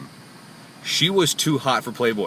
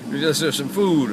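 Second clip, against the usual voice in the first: slow, higher-pitched begging caricature; caricature of homeless woman; 5 semitones higher, slower than usual. Retold or imitated. Driver imitating a homeless lady asking for food, slow caricature. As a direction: drag it out, mimicking her plea. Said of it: "I'm sorry for the horrible caricature".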